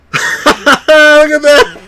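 high, loud, slow laughing cheer; laughing excitedly. Real, not acted. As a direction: laugh through it. Laughing after home run.

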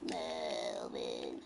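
very low, slow rumbling name; deep voice. Pretend. Calling for Melvin.

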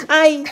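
high tickled squeal; squealing laugh. Real, not acted. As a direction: shriek of laughter.

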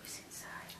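soft secret whisper. Acted. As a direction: hush it like a secret. Whispered again.

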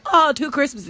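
a mock-happy "two Christmases"; mock delight. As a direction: mimic an excited kid. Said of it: "It wasn't".

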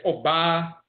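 "Oba" as aw-BAH, long open ending; Asante pronunciation. Shown as a demonstration. Lecturer teaching the word for woman, "Oba" as aw-BAH. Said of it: "in the Asante dialect".

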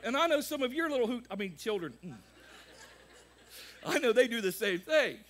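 loud, high pulpit preaching; preaching at full force. Acted. Pastor to his congregation about children, loud preaching. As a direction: preach it out to the back row.

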